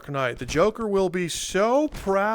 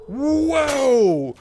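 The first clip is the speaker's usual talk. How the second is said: long high loud whoa; startled by a near miss; surprised whoa; 10 semitones higher, 7 dB louder than usual. Real, not acted. Gamer dodging an arrow, long high startled whoa.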